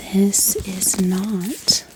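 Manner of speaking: normal talking voice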